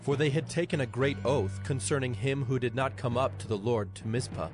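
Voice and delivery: ordinary delivery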